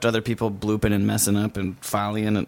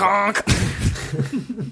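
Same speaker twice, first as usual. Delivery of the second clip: playful goose honk, pitched up; 9 semitones higher than usual. Acted. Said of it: "Did you just touch my boob and say".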